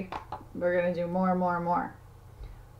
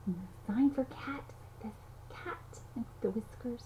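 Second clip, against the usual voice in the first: whisper; 2 semitones higher, 11 dB quieter than usual. Real, not acted. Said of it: "softly teaching sign".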